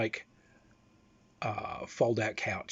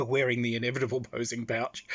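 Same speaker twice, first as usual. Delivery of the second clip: normal talking voice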